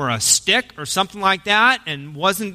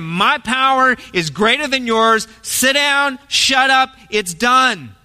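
higher, pressing command to the storm; forceful rebuke; 6 semitones higher, 3 dB louder, slower than usual. Retold or imitated. Jesus telling the storm his power is greater, forceful. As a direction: lay it on, full authority. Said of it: "He is laying it on".

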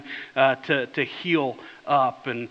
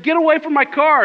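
much higher, louder yell; angry shout; 10 semitones higher, 7 dB louder than usual. Retold or imitated. Owner yelling at a stranger by his car, angry shout. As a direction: bark him away from the car. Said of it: "He said".

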